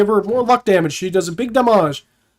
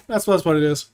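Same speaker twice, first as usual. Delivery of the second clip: ordinary talk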